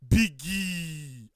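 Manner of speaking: policeman calling out. Retold or imitated. Policeman hailing a driver on the road, mock call. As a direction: call the nickname across the road like a cop.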